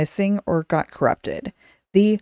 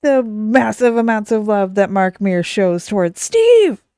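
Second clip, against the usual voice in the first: building mock yell; 3 semitones higher, 5 dB louder than usual. Shown as a demonstration. Host promising patrons she'll yell their names, hammed-up shout. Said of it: "I will yell all of your names out".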